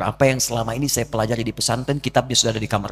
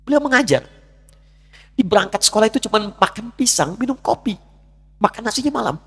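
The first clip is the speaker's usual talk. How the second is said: higher, louder fervent sermon; preaching at full force; 10 semitones higher, 3 dB louder than usual. Acted. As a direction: drive home the mother's sacrifice. Preaching mother's sacrifice.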